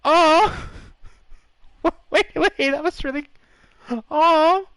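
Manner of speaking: high, loud startled cries, laughing in between; blurting out shock at a game voice line; surprised shriek. Real, not acted. Gamer startled by daughter's voice line, high delighted shriek. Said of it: "I wasn't expecting that voice line".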